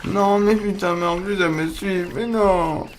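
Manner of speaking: high-pitched distressed wail; panicked whine. Real, not acted. Player is followed by a spider, whining in high panic. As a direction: whine in dismay at being chased. Poursuivi par une araignée.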